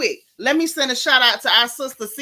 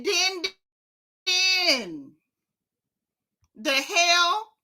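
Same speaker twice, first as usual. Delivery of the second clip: higher, drawn-out disbelief; incredulous repeat; 6 semitones higher, much slower than usual. Real, not acted. Vlogger repeating what he called someone, incredulous. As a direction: repeat it as if you cannot believe it. Still reacting to the nickname.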